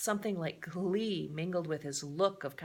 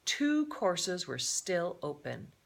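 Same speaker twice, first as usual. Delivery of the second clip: usual speaking voice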